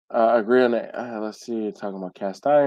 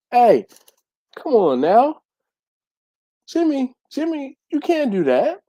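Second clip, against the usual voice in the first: mock scolding; 14 semitones higher, 7 dB louder than usual. Scolding someone named Jimmy.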